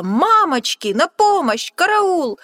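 loud, high shrieks for help; panicked cry. Acted. Snake shrieking for help at sight of the Gruffalo. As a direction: scream and run.

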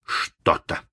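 breathless, whispered Что-то; hushed echo. Acted. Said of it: "повторил Малинс".

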